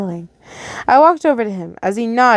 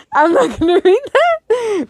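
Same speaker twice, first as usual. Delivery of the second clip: high teasing voice; 12 semitones higher than usual. Real, not acted. Teasing refusal to read note.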